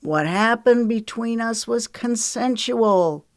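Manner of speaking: assertive. A narrator's reading. Wiley covers himself before the girls, asserting. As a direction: assert it smoothly. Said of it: "Wiley asserted".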